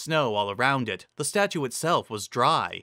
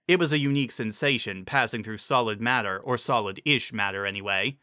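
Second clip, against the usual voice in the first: usual speaking voice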